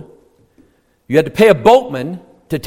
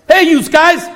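mock northeastern greeting; 9 semitones higher, 4 dB louder than usual. Imitating a regional greeting.

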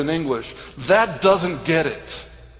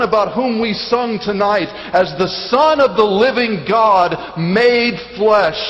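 raised, forceful sermon delivery; emphatic preaching; 5 semitones higher, 5 dB louder than usual. Real, not acted. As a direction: proclaim it with weighty emphasis. Preacher proclaims to congregation.

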